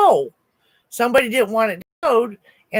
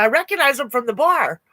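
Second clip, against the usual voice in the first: ordinary delivery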